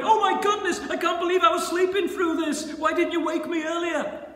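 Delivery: high, loud mock panic; flustered, hammed-up alarm on waking; crying out. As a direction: exclaim it in exaggerated dismay. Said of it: "shook himself and cried".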